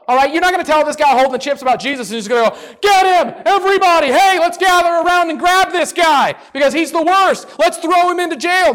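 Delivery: loud, high hammed-up yelling mob; mock angry mob. Preacher mocking fear of an angry crowd, hammed-up shouting. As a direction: rile up the crowd like a cartoon mob.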